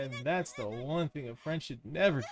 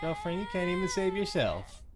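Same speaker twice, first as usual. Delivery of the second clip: taunting voice; 8 semitones higher than usual. Acted. Mocking voice answers crying heroine.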